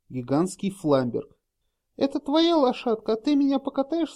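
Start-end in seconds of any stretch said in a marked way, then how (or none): 2.0-4.1 put-on high, teasing girlish voice; playful girl voice. A narrator's reading. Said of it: "спрашивала Кро".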